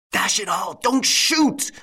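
high, loud roar of alarm. Acted. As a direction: shout it out to stop the shot. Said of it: "roared Lupin".

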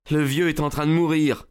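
high, urgent character voice; agitated son, pitched well up; distressed young friend. Acted. Friend announces his father is dying.

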